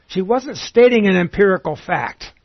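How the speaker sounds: normal talking voice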